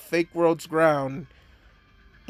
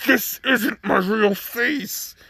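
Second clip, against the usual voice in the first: slow, higher, strained delivery; tormented voice; 6 semitones higher, much slower than usual. Retold or imitated. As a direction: force each word out in anguish. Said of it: "as he says".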